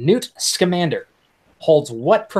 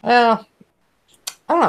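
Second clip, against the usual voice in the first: usual speaking voice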